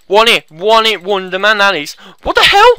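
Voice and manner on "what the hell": high, loud disbelief; loud gamer astonishment; shocked outburst. Real, not acted. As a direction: yell it in startled disbelief. Startled by what happens in game.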